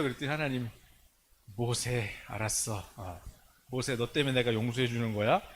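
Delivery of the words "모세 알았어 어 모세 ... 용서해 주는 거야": low, resigned divine voice; relenting God. Acted. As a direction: drop deep and grant it reluctantly. Voicing God relenting to Moses.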